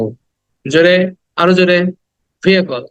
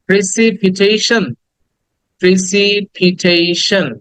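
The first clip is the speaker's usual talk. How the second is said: very slow "precipitation", pri-si-pi-tei-shan; repeat-after-me syllables; 2 semitones higher, much slower than usual. Shown as a demonstration. Teacher has class say it with him, slow syllables. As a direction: draw out each syllable of "precipitation". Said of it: "একবার বলি আমার সাথে".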